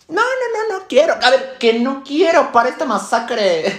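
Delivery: high, loud outburst of refusal; worked up. Acted. Dieter refusing a classmate's burger, worked-up outburst. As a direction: lose composure refusing, pitch shooting up. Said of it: "me alteré".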